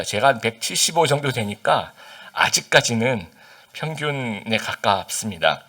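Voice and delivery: ordinary talk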